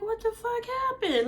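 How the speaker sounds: high, incredulous mock outrage; stunned disbelief. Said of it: "And I'm like".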